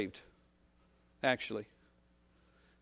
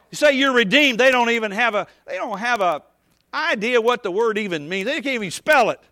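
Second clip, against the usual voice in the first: loud, high, shouting preacher at the pulpit; full-force preaching; 5 semitones higher, 8 dB louder than usual. Real, not acted. Preacher scolding the congregation, in a loud shouting preach. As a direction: hammer it home like a fired-up revival preacher.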